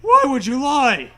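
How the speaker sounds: hammed-up outrage; loud mock indignant why; mock accusation. Ghost horse accusing.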